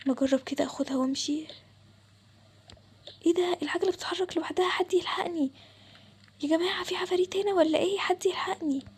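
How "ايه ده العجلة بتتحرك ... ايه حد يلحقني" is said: higher pitched, frightened call for help; panicky, calling out for someone to save him; scared cry for help. Acted. Boy sees the bike moving by itself, frightened.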